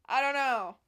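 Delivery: loud giddy shout; loud excited cry. Real, not acted. Vlogger giddy over her outfit, loud shout. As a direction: blurt it out loud with delight.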